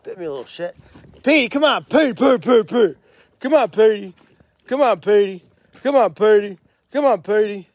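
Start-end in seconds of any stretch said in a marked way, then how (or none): 1.2-7.7 loud high call to the pet. Real, not acted. Owner calling pet in for bedtime, loud high calls. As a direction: coax him in with calls.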